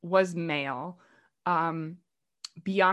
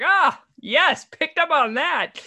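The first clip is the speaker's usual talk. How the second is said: high, loud smug aha; pleased with herself, spotting it; knowing aha; 8 semitones higher, 7 dB louder, slower than usual. Retold or imitated. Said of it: "I was like".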